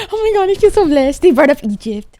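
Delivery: overflowing with joy; giddy loud gratitude; thrilled. Real, not acted. Thrilled, feels blessed.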